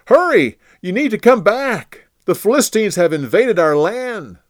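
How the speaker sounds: high, loud, urgent messenger call; breathless alarm to the king; urgent shout. Acted. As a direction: burst in with alarming news. Messenger rushes urgent news to Saul.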